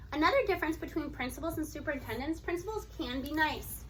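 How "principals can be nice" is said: ordinary delivery, nothing marked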